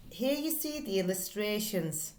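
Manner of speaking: ordinary delivery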